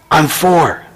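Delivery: put-on reply as a little, hushed child; whispering small child. Small boy telling his age to a caller, hushed child voice. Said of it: "a small voice whispered".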